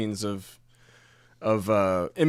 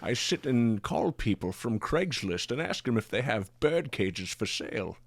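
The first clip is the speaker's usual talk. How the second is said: Sean Connery impression; 3 dB quieter than usual. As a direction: purr it like Connery. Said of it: "I can do Sean Connery pretty well".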